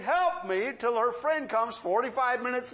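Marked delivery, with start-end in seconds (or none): 0.0-0.7 higher mock yell for help; retold cry for help. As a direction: imitate her calling out for help. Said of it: "three hours saying".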